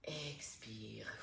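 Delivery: soft whisper, barely voiced; whispered breath cue. Coach cueing the exhale to the class, whispered.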